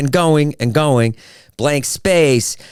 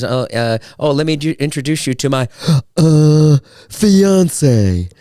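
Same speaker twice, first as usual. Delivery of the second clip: hammed-up snobby intro with a gasp before a louder "fiance"; pretentious introduction; 3 semitones higher, 4 dB louder, slower than usual. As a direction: show off the fiancee with a dramatic gasp. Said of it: "because I'm trying to elevate myself above everyone else".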